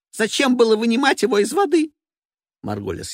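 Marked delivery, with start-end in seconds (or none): 0.1-1.9 shrill diva voice. Acted. Evelina voiced.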